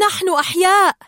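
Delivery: high, loud cry of relief; exultant shout. A narrator's reading. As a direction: shout it out, thrilled to be alive. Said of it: "صاح مارلين".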